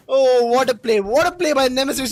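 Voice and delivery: high, admiring exclamation; elated praise. Real, not acted. Caster praising the player after a double kill, elated. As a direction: marvel aloud at the play.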